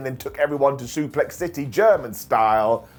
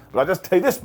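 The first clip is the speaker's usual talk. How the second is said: usual speaking voice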